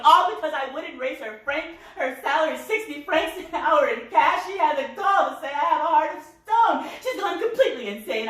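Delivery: high voice climbing into strident indignation; outraged tirade. Acted. Mother denying to Yvonne she is selfish, outraged. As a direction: work up to a scandalized peak.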